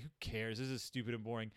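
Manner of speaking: low, soft mock indifference; quietly dismissive imitation; bored, apathetic Mama. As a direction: toss it off like a mother who has given up. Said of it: "just being like".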